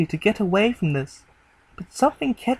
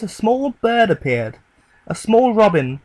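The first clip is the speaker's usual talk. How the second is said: ordinary delivery, nothing marked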